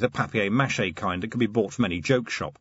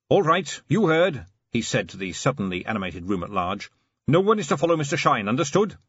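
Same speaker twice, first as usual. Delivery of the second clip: commanding; 3 semitones higher than usual. Acted. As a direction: take charge of the room. Carrot giving orders to room.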